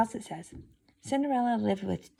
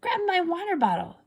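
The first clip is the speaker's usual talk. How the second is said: high, louder demand; crying out stepsister; 12 semitones higher, 3 dB louder than usual. A narrator's reading. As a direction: call out the order sharply and high. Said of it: "cried the other stepsister".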